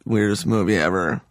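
normal talking voice

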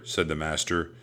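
plain reading voice

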